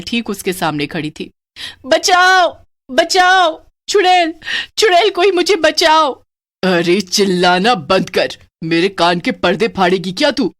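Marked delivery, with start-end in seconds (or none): 1.9-6.1 high, loud screams for help; terrified screams. Acted. 6.6-10.5 scolding witch. Acted.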